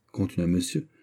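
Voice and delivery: usual speaking voice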